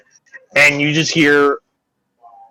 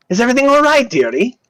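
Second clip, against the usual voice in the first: high, cooing nurse voice; high-voiced motherly concern, acted; fussing nurse; 11 semitones higher, faster than usual. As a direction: lift the pitch like a doting old-fashioned nurse.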